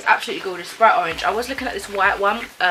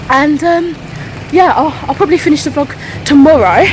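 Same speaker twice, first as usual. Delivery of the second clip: louder, higher, boxy recorded voice; talking into a phone in a busy shop; phone-filmed voice in a noisy shop; phone recording; 4 semitones higher, 11 dB louder than usual. Real, not acted. Filming in a shop, recorded earlier.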